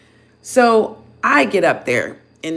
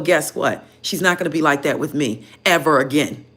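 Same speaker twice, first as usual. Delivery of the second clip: ordinary delivery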